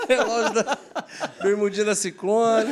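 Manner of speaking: loud, high, laughing through the words; laughing tease. Real, not acted. Host teases Brunão's outfit, laughing loudly. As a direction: tease him while laughing.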